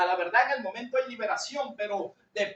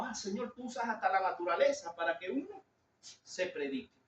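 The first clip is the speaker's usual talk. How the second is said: retold quiet prayer; 4 semitones lower, 8 dB quieter than usual. Retelling musing prayer to God.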